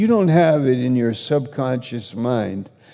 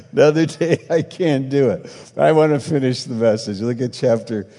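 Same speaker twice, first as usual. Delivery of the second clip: laughing; 3 semitones higher, 4 dB louder than usual. Real, not acted. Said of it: "so I can speak like this".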